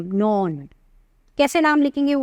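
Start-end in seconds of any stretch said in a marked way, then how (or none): 0.0-0.7 "नॉन" said as "non" with a short o, never as "one"; pronounce as non. Shown as a demonstration. Teacher correcting students: "नॉन" is read non, not "one". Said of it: "इसको वन नहीं पढ़ेंगे हम".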